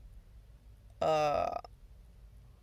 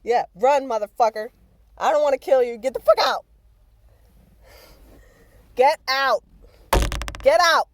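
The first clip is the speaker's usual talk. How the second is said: much louder, higher shouts; loud yelling; 8 semitones higher, 15 dB louder than usual. Real, not acted. Yelling at the fleeing roach to get out. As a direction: shout the bug out the door.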